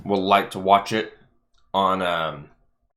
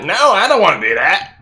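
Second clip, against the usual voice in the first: mock whiny refusal; 10 semitones higher, 7 dB louder than usual. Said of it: "It's like".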